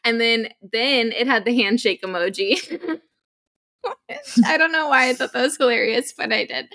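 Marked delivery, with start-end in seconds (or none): 3.8-4.4 high giggle. Real, not acted. Laughing at the emoji.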